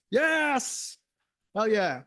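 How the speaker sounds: high, loud burst of joy; excited cheer. Real, not acted. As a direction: cheer it out like great news. Cheering news about TypeScript.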